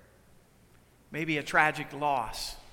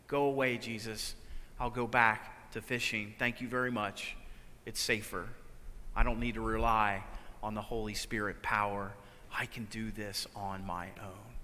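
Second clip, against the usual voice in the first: soft, low, resigned murmur; weary withdrawal; 10 semitones lower, 8 dB quieter than usual. Retold or imitated. A tired believer pushing Jesus away, soft and low. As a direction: let it sink away, giving up.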